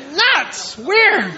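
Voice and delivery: short, high-pitched mock disgust; mocking onlooker. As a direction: judge them in a high, scornful aside. Onlookers judging the worshiper.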